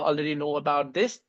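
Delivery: normal talking voice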